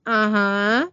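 a limp, half-hearted uh-huh; lightly voiced unconvinced agreement; unconvincing. A narrator's reading. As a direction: agree without meaning it at all. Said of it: "Cecily very unconvincingly says".

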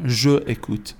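je écoute as two separate words, je then écoute; uncontracted form. Shown as a demonstration. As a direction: say je in full before écoute, without elision.

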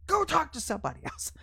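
high-pitched, fed-up dismissal; mock angry. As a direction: act out sending God away in a huff. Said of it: "I've gotten mad at God before".

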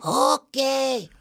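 high, strained alien voice; wobbly high creature voice; Boba Fett voice. Retold or imitated. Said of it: "you exactly sounded like right there".

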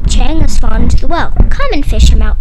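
loud urgent calling. Acted. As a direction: call for help across the yard. Boy calls to old man.

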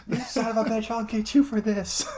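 hammed-up high angry movie quote; mock furious. Quoting Frankenstein's outburst to the listeners, mock furious. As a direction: rage at the failure, overplayed.

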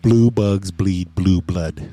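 slow, evenly spaced tongue twister; careful tongue twister. Shown as a demonstration. Co-host offers a tongue twister, slow and careful. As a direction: recite it slowly, landing every B.

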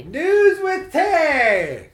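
very high, loud, long-held holler; shouted segment jingle. Pretend. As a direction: belt out the title, stretching every word. Calls out segment title.

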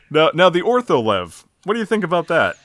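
normal talking voice

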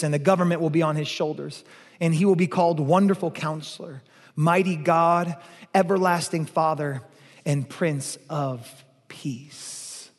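solemn, slowing to a hushed close; scripture reading. Acted. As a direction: read the prophecy with growing reverence.

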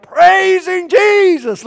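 long, high, full-voiced yell; imitated shouted praise. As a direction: holler it with stretched vowels. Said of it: "like this".

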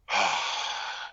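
long voiceless sigh; exasperated sigh. Real, not acted. Podcaster dreading the next cast names, long weary sigh. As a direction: let out a long exhale.

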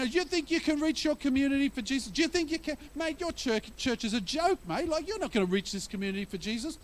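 mocking scoffer. Preacher voicing mockers belittling the church, high and sneering. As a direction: belittle them like a smug bloke. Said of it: "They're saying to us right now".

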